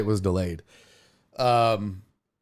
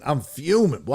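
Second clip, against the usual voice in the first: usual speaking voice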